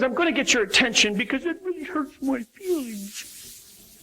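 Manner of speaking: put-on high, slow, pouting little-girl voice; hammed-up hurt feelings, high and drawn out; pouty pitch. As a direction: whine it like a sulky daughter. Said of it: "it starts off with the pouty pitch".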